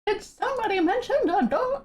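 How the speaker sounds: high mouse voice copying the clip; Mickey Mouse impression. Shown as a demonstration. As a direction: imitate the clip's mouse. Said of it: "do that with my voice".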